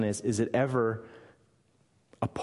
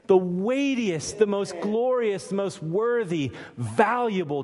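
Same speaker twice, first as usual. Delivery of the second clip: higher-pitched, insistent build of praise; emphatic preaching; 7 semitones higher than usual. Real, not acted. Preacher exalting God to the congregation, fervent and emphatic. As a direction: pile up each phrase with rising fervor.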